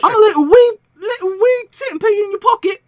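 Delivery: very high, loud tiny voice; little centipede. Acted. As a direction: pipe up as a wee creature. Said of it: "I got a little centipede".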